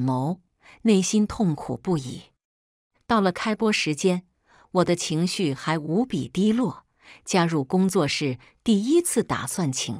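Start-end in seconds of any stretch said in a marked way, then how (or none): none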